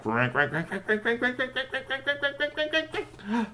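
rising ratchet imitation; mouthed wind-up clicks ending very high; cranking noise.

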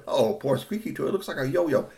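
usual speaking voice

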